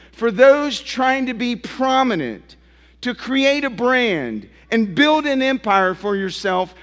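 normal talking voice

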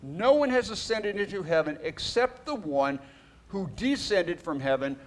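scripture reading. Acted. Reads scripture aloud.

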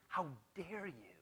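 hushed, slow indignant rebuke; quiet outrage aimed at the disciples. Retold or imitated. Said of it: "He was indignant".

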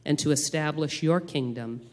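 solemn, measured public prayer; praying aloud. Acted. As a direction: lead the church in earnest prayer. Leads congregational prayer.

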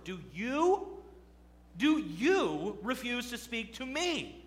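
slow, lifted, lightly voiced arrogant Pilate; arrogant inflection. Preacher imitating Pilate's arrogance to the congregation. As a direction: drag it out with imitated disdain. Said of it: "I like to imagine the inflection on these words".

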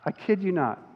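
ordinary talk

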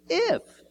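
If flung up high as a taunting question; Satan's doubting If. Retold or imitated. Preacher voicing Satan's challenge to Jesus, leaping up on If. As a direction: leap way up on If. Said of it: "Satan comes and says".

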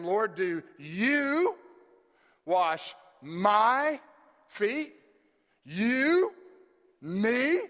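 incredulous rising "you?" and "my?", loud and high; stress on YOU and MY. Shown as a demonstration. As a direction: stretch "you" and "my" upward in disbelief. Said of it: "if you read it the way that it's in the Greek".

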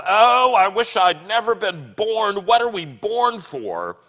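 high, loud anguished wail of regret; wailing ghost lament. Acted. Ghost crying out to the spirit in loud, high despair. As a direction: moan it out like a lost soul in despair.

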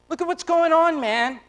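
ordinary delivery

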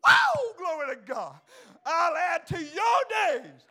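very loud, high, stretched-out shouts; full-force preaching. Acted. Preacher declaring God's answer to the congregation, shouted at full force. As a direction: build to a roaring pulpit climax.

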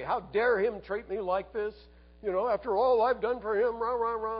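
high, indignant mock rant; angry ranting. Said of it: "they're ranting against God".

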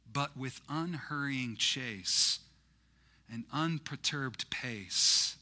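very slow, measured reading; deliberate verse, settling after a strong start; poem recitation. Acted. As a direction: recite unhurriedly, stretching the stressed words.

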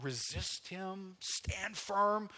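firm exhortation; charging command. Acted. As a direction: press each command home deliberately. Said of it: "he start charging us".

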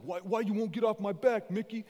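hammed-up Rocky pushing back at his trainer. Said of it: "He's like".

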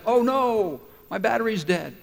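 theatrical "uh-oh" moment; acted dismay. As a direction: play up the sinking realization. Acts out finding dead battery.

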